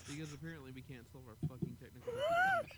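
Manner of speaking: faint delayed repeat; echo. Real, not acted. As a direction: drift back like a distant echo. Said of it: "that echo".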